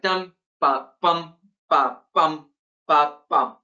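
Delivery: steady alternating beats, DUM ba BUM ba BUM; rhythmic beat. Shown as a demonstration. Lecturer sounding out the poetry's rhythm, in steady spoken beats. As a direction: tap out the meter by voice, each "ba" and "bum" a separate beat. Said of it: "there's a certain rhythm".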